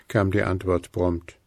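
plain reading voice

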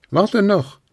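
calling out. A narrator's reading. Hares call to Luzi to wait. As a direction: shout after her. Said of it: "riefen die Hasen".

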